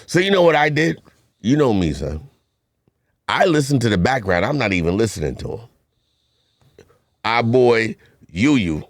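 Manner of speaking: ordinary talk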